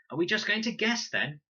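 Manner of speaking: high, loud defiant challenge; challenging. A narrator's reading. Con challenging the others, loud and defiant. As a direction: square up and demand an answer.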